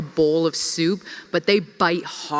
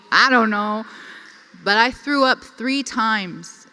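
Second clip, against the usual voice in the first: ordinary talk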